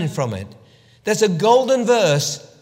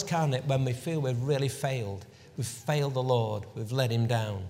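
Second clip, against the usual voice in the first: hushed sorrow; 5 semitones lower, 6 dB quieter than usual. Acted. Preacher tells Peter weeping bitterly, hushed and sorrowful. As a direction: let the pain sink in quietly. Poignant denial scene, sober.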